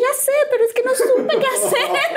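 high, drawn-out, laughing whine; mock wail. Daughter confessing to her mother, high mock wail. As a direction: wail it helplessly, half laughing. Retold wailing reply to mother.

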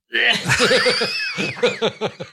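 high burst of laughter with a shout. Real, not acted. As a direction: cackle up high at the punchline. Laughs at own punchline.

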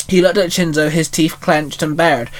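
ordinary delivery, nothing marked

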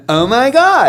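high, loud parody of amazement; mock gushing. Husband parodying his wife's constant exclamation, mock gushing. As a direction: squeal it as a running joke.

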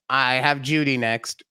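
ordinary talk, nothing marked

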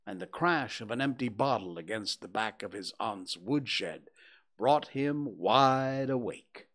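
plain reading voice

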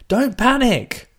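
high, strained mock shout over screaming; mock panicked calling. Acting out Susan trying to calm a screaming crowd, high mock shout. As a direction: cry out in comic panic, high-pitched. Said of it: "she starts going".

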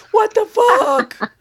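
high, loud, drawn-out wail; hammed-up cry of disbelief, stretched slow; retold outrage. Reader re-enacting her reaction to a chapter, retold outrage.

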